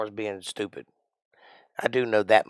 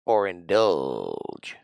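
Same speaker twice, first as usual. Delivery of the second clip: slow, lower, drawn-out indulge; genie tease; 5 semitones lower, much slower than usual. Shown as a demonstration. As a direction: stretch it like a genie granting wishes. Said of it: "I learned that trick from I Dream of Jeannie".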